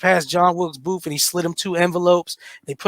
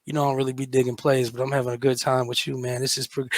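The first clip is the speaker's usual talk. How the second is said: low and soft, lightly voiced Lincoln sweet-talking; laid-back Lincoln; 8 semitones lower, 8 dB quieter than usual. Lincoln sweet-talks his date during the play in a low, soft voice. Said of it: "He's like".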